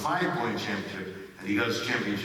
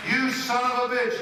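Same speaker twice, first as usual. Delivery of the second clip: mock outburst; 13 semitones higher, 5 dB louder than usual. Shown as a demonstration. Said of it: "Is that funny?".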